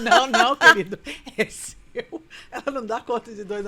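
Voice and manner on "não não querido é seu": laughing loud insistence as the nurse; nurse insisting, laughing. Retold or imitated. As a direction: belt out the nurse's reply while laughing. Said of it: "A enfermeira fala assim". (enfermeira rindo e insistindo alto)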